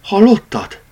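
high, shaky frightened question; a scared cat catching a faint cry; trembling voice. A narrator's reading. Cat frightened by a sound, asking his friend in a trembling voice. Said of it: "kérdezte remegő hangon".

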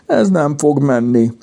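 high, whiny mock-crying voice; tearful whimper. A narrator's reading. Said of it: "pityeredett el Mimi".

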